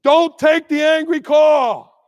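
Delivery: loud, slow command; loud slow warning; emphatic warning. Real, not acted. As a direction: drive the rule home hard.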